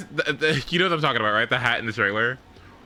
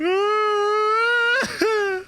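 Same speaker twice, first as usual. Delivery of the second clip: high wheezing laugh. Real, not acted. Streamer losing it over Miss Piggy, squealing laugh.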